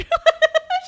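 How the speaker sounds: shrieking high laugh. Real, not acted. Friend cracking up at the heroine, high laugh.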